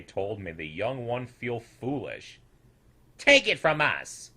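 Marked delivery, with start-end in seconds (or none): 3.2-4.3 put-on louder, knowing old voice; condescending elder. A narrator's reading. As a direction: talk down to the youngster. Lou lecturing the young one.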